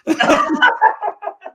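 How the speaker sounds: high, warm burst of laughter; delighted laugh. Real, not acted. Friend laughing at his own story. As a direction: laugh at the coincidence.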